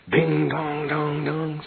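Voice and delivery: ringing the bell that announces a letter from the guru; bell imitation.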